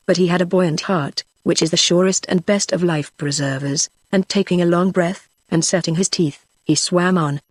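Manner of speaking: usual speaking voice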